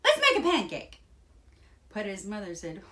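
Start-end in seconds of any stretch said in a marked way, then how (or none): 0.0-1.0 high, loud eager cry; excited shout. A narrator's reading. Jack urges his mother to start cooking, shouting. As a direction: call it out like an eager boy. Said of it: "shouted Jack".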